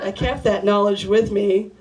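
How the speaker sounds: ordinary talk, nothing marked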